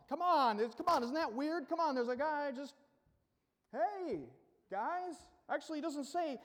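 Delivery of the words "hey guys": high, airy hello to friends; lightly acted offhand greeting; casual greeting. Man walking on water greets the boat, mock casual. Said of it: "there's a guy just walking along".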